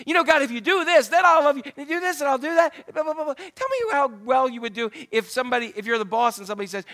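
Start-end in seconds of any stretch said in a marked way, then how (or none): 0.2-3.3 bargaining prayer. Retold or imitated. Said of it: "prayers where we tell God what".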